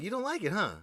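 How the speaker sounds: teasing. Retold or imitated. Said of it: "And I was like".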